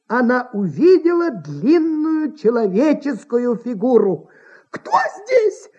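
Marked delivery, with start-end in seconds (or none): 4.7-5.7 high startled cry of fright; frightened cry. A narrator's reading. Startled actress cries out in fright. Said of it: "вскрикнула она в ужасе".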